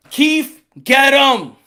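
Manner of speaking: firm order at normal pitch; Al Heyman. Acted. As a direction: call the underling out clearly. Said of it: "Al Heyman's like".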